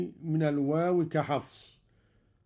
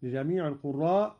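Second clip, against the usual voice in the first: usual speaking voice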